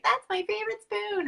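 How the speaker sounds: high, bright imitation of a young girl; high child voice. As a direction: pitch up high as an eager kid. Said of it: "be like".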